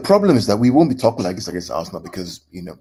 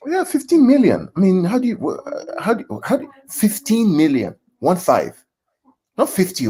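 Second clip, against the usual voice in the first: amazed disbelief; 9 semitones higher, 5 dB louder than usual. Real, not acted. Amazed at cheap transfer fee.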